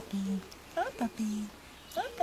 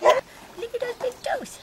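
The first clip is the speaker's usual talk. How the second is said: excited squeal; 11 semitones higher, 7 dB louder than usual. Real, not acted. Excited coo.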